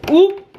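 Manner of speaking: short, high, loud "oop"; startled oop. Real, not acted. Vlogger spilling spinach as she fills the blender, startled oop. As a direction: let out a quick high oop at a slip.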